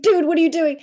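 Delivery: mock deer buddy. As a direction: voice the buddy deer, casual and loud. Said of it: "I'm pretty sure it was like".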